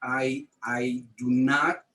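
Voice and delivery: normal talking voice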